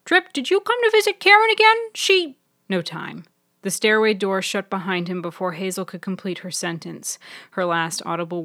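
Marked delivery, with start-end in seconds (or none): 0.0-2.4 loud, high, rapid call; calling after him. A narrator's reading. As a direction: call out after someone hurrying away. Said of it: "as Hazel called after him".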